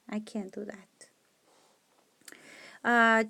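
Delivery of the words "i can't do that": whispered verdict to herself. Real, not acted. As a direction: say it under your breath. Whispered aside while checking.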